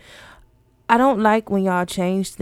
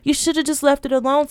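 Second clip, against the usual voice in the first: exasperated rant; 9 semitones higher than usual. Real, not acted. Ranting at companies.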